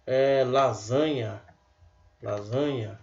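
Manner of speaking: Lasanha with the S voiced as Z, lazanha; slow lazanha. Shown as a demonstration. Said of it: "Você tem casal".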